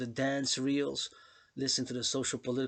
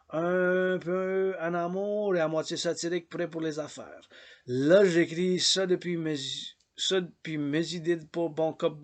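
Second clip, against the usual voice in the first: higher, louder reading of a proclamation; poetic declamation; 5 semitones higher, 7 dB louder than usual. Acted. Addressing Quebecers, lively poetic declamation in French. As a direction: declaim it to the whole province.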